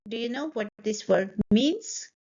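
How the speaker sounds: "means" swoops upward; rising question tune. Shown as a demonstration. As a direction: demonstrate the question rise on "means". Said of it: "my voice is rising".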